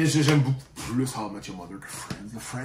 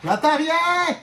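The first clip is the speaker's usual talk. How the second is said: yelling across the room; 6 dB louder, much slower than usual. Real, not acted. Can't hear, calls back.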